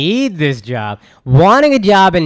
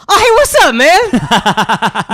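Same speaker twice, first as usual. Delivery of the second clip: much higher, loud, put-on breezy voice; cheeky casual greeting; 12 semitones higher, 6 dB louder, much slower than usual. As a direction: greet the boss like an old buddy.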